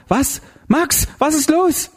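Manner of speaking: high, drawn-out alarmed question; startled cry. A narrator's reading. Karin to Max as he attacks, startled cry. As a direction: wake with a start, pitch leaping up.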